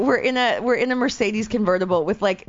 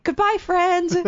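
high sing-song goodbye; pitched-up friendly wave-off; mock cheery cop; 11 semitones higher, slower than usual. Cops waving cheerfully.

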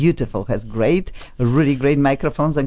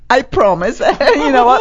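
real laughter, higher and louder; laughing; 9 semitones higher, 6 dB louder than usual. Real, not acted. Host laughing with the guest over a promise, laughing. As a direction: laugh through the words.

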